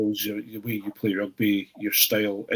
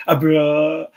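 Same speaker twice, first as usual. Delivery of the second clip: a bra said higher and louder in a mock South African accent; 5 semitones higher, 8 dB louder than usual. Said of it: "the South African accent".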